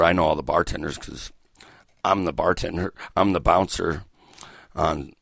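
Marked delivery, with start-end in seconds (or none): none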